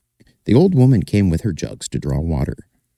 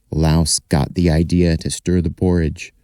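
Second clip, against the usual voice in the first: ordinary delivery, nothing marked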